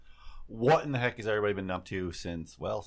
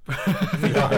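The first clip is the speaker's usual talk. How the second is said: laughing; 11 semitones higher, 12 dB louder than usual. Real, not acted. Laughing echo.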